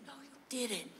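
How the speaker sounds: very soft, hushed disbelief; astonished but keep it secret; whisper yell. Acted. Friend doubting the kid with sour balls, whisper yell. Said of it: "lot of whisper yells as a child".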